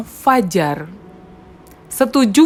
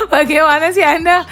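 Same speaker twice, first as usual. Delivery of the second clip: laughing; 8 semitones higher than usual. Real, not acted. Host teasing guest, laughing.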